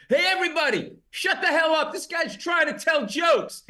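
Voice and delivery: very high, loud mock yell to the bar; drunk guy shouting. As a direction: yell to silence the whole room. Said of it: "he goes".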